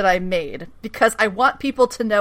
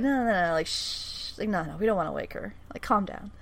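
hushed, much softer and quick; mock soothing shush; 3 semitones lower, 13 dB quieter than usual. Villain tells caller not to wake Molly, hushed mock soothing.

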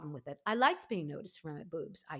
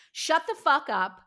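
sudden loud, higher snap at a crying friend; retold shout; 8 semitones higher, 11 dB louder than usual. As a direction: bark it out to cut off the tears. Retelling snapping at crying friend.

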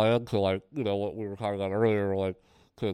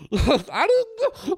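scared; 4 dB louder, much slower than usual. Retold or imitated. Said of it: "such a funny, over-the-top, like, reaction".

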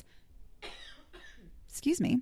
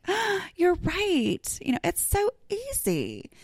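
high, very slow, soft sarcastic drawl; sarcastic agreement; 11 semitones higher, 4 dB quieter, slower than usual. Retold or imitated. Answering commenters about key hooks, high, slow and sarcastic. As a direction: fake sweet agreement dripping with irony. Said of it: "And I'm like".

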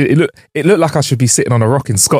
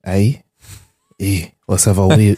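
put-on Scottish accent. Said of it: "Give me your best Scottish detective".

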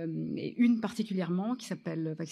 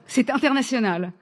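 loud, raised pitch-meeting claim; emphatic retold bluff; 4 semitones higher, 8 dB louder than usual. Writer selling a nonexistent story to producer, loud and emphatic. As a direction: proclaim it big to sell the bluff.

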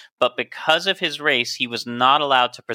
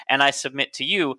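ordinary talk, nothing marked

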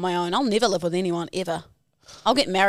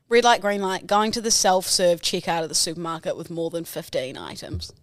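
ordinary talk, nothing marked

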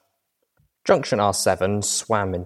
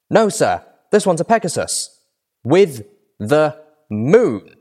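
guard voice; 7 semitones higher, 5 dB louder, slower than usual. A narrator's reading. Guard answers his boss.

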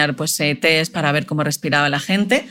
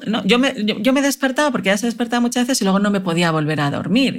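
ordinary talk

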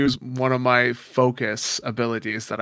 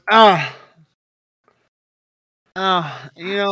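pained grunt; 6 semitones higher, 4 dB louder, slower than usual. Acted. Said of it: "and he just goes".

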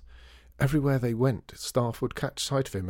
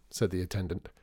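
ordinary delivery

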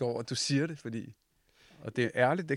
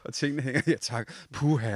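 louder, laughing through the words; amused outburst with laughter; laughing banter; 2 semitones higher, 8 dB louder than usual. Real, not acted. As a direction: laugh it off loudly. Flattered, laughing banter after a compliment.

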